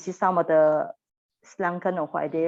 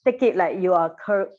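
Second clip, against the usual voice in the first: usual speaking voice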